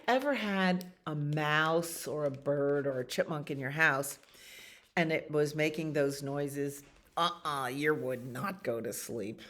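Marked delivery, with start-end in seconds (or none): none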